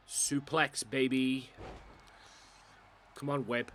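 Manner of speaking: live wrestling play-by-play; wrestling commentator. Pretend. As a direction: call each move as it lands. Calls the game moves live.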